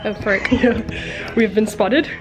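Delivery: usual speaking voice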